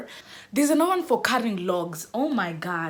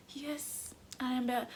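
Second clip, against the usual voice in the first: much softer, weak sickly answer; 4 semitones higher, 12 dB quieter, slower than usual. Retold or imitated. Faking illness to the watchman, weak faint reply. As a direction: answer faintly like you're ill. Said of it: "I'm looking so sickly".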